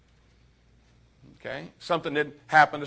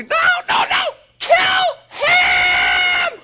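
put-on very high, loud shrieking child; little girl screaming at mom to kill her brother; 11 dB louder, much slower than usual. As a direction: cut mom off with a hysterical shriek.

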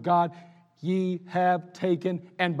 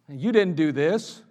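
ordinary talk

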